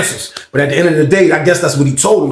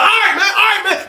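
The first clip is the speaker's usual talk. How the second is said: shouted. Retold or imitated. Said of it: "It was like".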